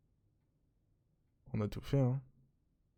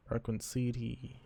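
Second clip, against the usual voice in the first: put-on slow, mangled English accent; a hint of a broken English accent, drawn out; bad English accent; 3 dB quieter, slower than usual. Gamer reading game text in bad English accent. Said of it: "mon accent anglais".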